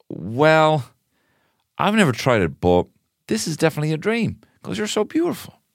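high, hammed-up dreamy voice; mock starry-eyed wonder; deluded dreamer act. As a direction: play lovestruck conviction, pitched high. Dreamer insists he can fly.

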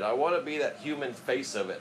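ordinary delivery

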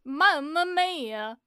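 put-on Italian accent. Mafia cowboy bit.

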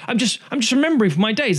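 high, defensive mock politician voice; lightly voiced politician brushing it off; mock posh excuse. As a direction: whine out the excuse like a caught toff. Mocking the prime minister's excuse.

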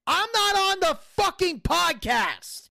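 high, slow indignant yell; retold angry screaming. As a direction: re-enact an exasperated protest, stretching every word. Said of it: "I am fucking screaming at Alan Funk".

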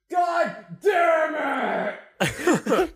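high, drawn-out furious yell; angry yell. Retold or imitated. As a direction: let it rip in frustration from the next room. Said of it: "I just hear this".

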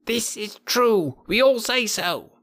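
high, slow chorus of monkeys; monkeys shouting together. A narrator's reading. Monkey troop cheering each speaker, high and slow. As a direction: chant it as the whole troop. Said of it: "they would all shout together".

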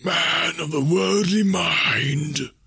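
high, very slow spectral wail; commanding ghost. A narrator's reading. Ghost confronting the kneeling Scrooge, wailing challenge. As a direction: intone it as a haunting phantom.

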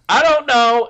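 put-on very high, loud childish I don't know; kid voice. Kid asked what he wants done, shrill kid voice. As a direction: squeak it out like a clueless kid. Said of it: "I'm trying to do a kid voice".

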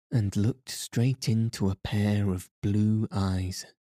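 ordinary delivery

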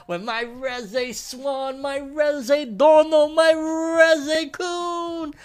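high, slow fangirl squeal; excited anime fan. Real, not acted. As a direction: swoon over a favorite character, dragging each word. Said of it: "I sounded like a little weeb there".

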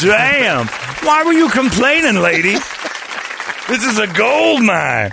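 high loud impressed exclaiming; amazed shout. Retold or imitated. Fed amazed by size.

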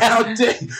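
high, indignant squeal with a laugh in it; mock outrage. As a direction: act affronted, hammed up. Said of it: "it pissed me off so much".

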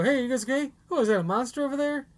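imagined bystander. Pretend. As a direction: lightly act a random passer-by calling in. Imagined voice on the podcast.

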